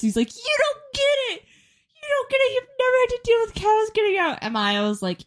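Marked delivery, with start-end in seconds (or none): 0.3-4.4 much higher mock outrage; mock dad angry. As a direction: parody the dad losing it. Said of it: "my dad was so pissed".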